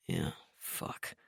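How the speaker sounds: breathy, very soft sheepish curse; sheepish whisper. A narrator's reading. Trent, embarrassed.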